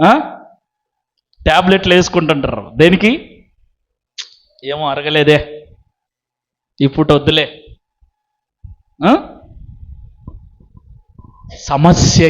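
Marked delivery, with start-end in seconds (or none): 4.6-7.6 low, slightly softer grumble of indigestion; reluctant sufferer. Acted. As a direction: lightly act out declining a meal. Voicing someone with indigestion declining food. (అరగక వద్దనే నీరసపు స్వరం)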